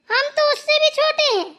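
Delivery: high, loud, insistent objection; indignant protest. Acted. Insisting to grandmother she is younger, indignant. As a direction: push it out loud and high, offended.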